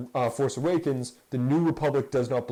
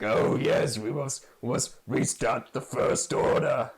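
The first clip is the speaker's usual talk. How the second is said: Snoke voice. Retold or imitated. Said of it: "the Snoke is out there being like".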